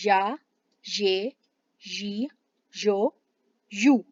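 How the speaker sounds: या ये यी यो यू one by one with pauses; slow drill of या ये यी यो यू; syllable drill. Shown as a demonstration. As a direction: spell out each syllable. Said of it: "आप इसे य करेंगे".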